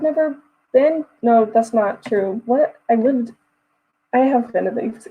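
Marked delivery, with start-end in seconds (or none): none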